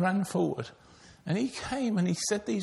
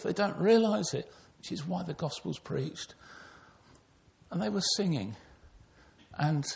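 ordinary delivery

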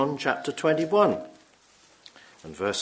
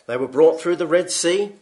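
louder, higher preaching that swells; building sermon; 7 semitones higher, 12 dB louder than usual. Acted. Preacher urging the congregation, louder preaching. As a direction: press the point home to the hall.

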